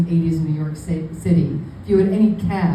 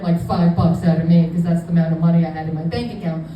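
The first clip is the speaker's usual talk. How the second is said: usual speaking voice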